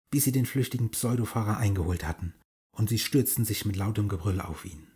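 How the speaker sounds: usual speaking voice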